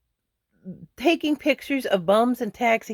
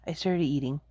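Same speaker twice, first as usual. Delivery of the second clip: usual speaking voice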